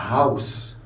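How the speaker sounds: "house" opened with an audible H; H pronounced. Shown as a demonstration. Teacher showing the German word, H aspirated. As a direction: puff the H at the start of "house". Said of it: "Le H se prononce".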